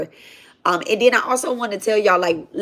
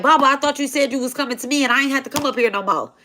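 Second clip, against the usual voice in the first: indignant devotee; 4 semitones higher, much faster than usual. Retold or imitated. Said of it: "He was like".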